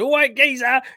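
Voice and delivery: put-on loud, high caricature of an Essex accent; hammed-up Essex shout; mock Essex geezer. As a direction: yell it like a cartoon Essex geezer. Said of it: "when someone goes".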